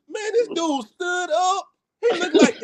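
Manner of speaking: high, strained, half-crying exclamation; telling friends how scared he was; mock alarm. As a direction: act out the shock as he rises. Retelling the giant standing up.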